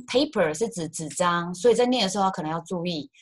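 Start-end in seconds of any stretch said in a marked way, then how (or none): 0.1-0.5 "paper" with a long ay in the first syllable; "paper" starting like "pay"; long vowel. Shown as a demonstration. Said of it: "如果是长音paper".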